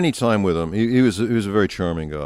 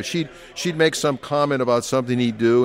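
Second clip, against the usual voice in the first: usual speaking voice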